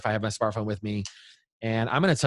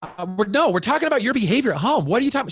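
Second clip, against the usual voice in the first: high, worked-up dad cutting in; flustered protest; 11 semitones higher, 5 dB louder, faster than usual. Retold or imitated. Dad arguing with his daughter about her behavior, retold flustered protest. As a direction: re-enact a rattled parent pushing back.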